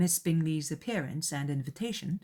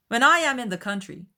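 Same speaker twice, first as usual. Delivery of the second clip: cheerful host; 7 semitones higher, 7 dB louder than usual. A narrator's reading. Bingley voiced.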